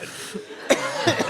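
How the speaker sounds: laughing cough. Real, not acted. Laughing at heckler story.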